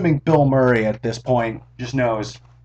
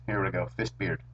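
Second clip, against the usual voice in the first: lower, much softer, a lightly put-on beard voice; talking fist-beard voice; 5 semitones lower, 12 dB quieter than usual. Vlogger pretends his beard speaks to viewers, low and hushed. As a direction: let the beard itself talk, low and hushed. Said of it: "it's talking like this".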